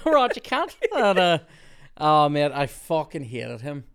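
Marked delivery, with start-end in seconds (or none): 0.1-0.7 put-on high, loud comic Australian accent; mock Australian. Worker riffing on how they pronounce it, mock Australian. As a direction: exaggerate the Australian vowel in a hammed-up voice. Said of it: "they pronounce it cant".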